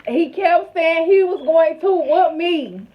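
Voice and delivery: slow, gloating victory boast. Real, not acted. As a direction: rub the win in. Gloating over the winner.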